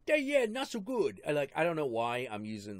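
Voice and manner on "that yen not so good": high, broad hammed-up Chinese accent; mock Chinese accent. Friend repeats the yen joke in a broader mock Chinese accent. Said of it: "stereotypical Chinese accent".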